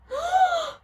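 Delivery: very high sudden gasp; big startled gasp; shocked gasp. A narrator's reading. Shock beyond a rock.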